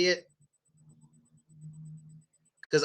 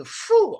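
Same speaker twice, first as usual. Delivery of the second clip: a high blown phew; phew of bad breath. Shown as a demonstration. Teacher miming bad morning breath. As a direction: blow out like smelling morning breath. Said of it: "our breath".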